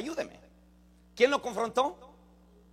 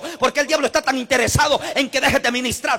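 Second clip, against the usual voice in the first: loud, driving rhetorical questions; full-force preaching; 3 semitones higher, 6 dB louder than usual. Real, not acted. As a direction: hammer each repeated question at the congregation.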